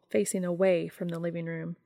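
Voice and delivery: plain reading voice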